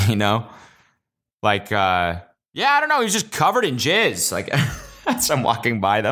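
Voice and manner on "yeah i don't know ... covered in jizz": much higher, sing-song gossip tone; hammed-up chatty phone voice; fake phone chatter. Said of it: "say the weirdest shit".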